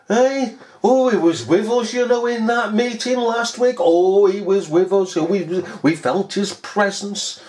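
high gushing mock rapture; mock-ecstatic, high and gushing; mocking churchgoer. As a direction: ham up their swooning excitement. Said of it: "You're just mocking them".

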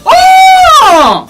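loud, high-pitched startled "oh"; startled cry. Real, not acted. Streamer reacting to a sudden game moment, startled high cry.

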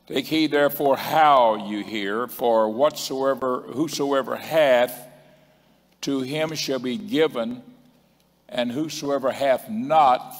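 slow, loud sermon scripture reading; preaching the verse. Acted. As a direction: proclaim the verse to the church. Preacher reads the verse with force.

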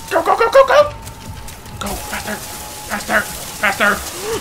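panic, urge them on; frantic urging. Real, not acted.

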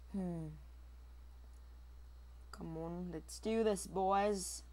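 usual speaking voice

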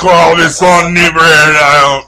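slow, slurred mumbling drawl; Boomhauer. Pretend. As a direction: mumble it out like a lazy Texan. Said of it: "All right, Boomhauer".

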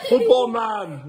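high, slow announcer-like holler; playful calling out. Real, not acted. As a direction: stretch the nickname out high. Playfully calling to his child.

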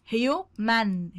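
teacher slowly sounding out human for pupils; slow drawn-out demo. Shown as a demonstration. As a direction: say human very slowly, stretching each syllable. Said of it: "teacher drills word pronunciation".

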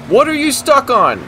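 very high, exasperated shriek; angry yell. Real, not acted. Gamer raging at a truck that will not move, angry yell. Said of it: "I'm so angry right now".

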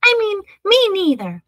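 high little mouse correcting its muddled words; tiny little voice. A narrator's reading. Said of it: "said a tiny little voice".

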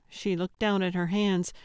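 usual speaking voice

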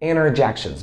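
interjections with the T dropped, "innerjections"; American reduced T. Shown as a demonstration. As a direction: say interjections as "innerjections", falling at the end. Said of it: "some Americans pronounce it this way".

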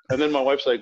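ordinary delivery

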